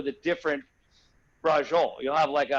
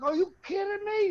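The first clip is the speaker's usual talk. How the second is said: much higher, shocked cry of disbelief; alarmed disbelief. Retold or imitated. Patient seeing the doctor's bigger tool, high alarmed disbelief. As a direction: react like the tool is far too big.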